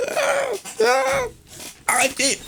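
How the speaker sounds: very high falsetto yelps; mock panic. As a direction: squeal as if being roughly arrested. Pretend arrest, crying out in protest. (panique jouée, petits cris suraigus)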